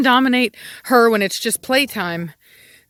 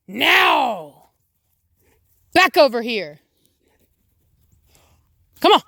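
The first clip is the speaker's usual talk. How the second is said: sharp high calls, louder, dragging the vowels; calling dogs; 6 semitones higher, 3 dB louder, slower than usual. Real, not acted. Owner calling the dogs back in from the yard, loud and commanding. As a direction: make them come back now.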